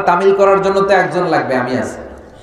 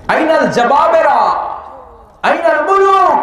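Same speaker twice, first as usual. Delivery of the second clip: shouting; 8 semitones higher than usual. Acted. God demanding where the tyrants are, shouting high. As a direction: cry out the challenge to tyrants. Preacher voicing God's challenge.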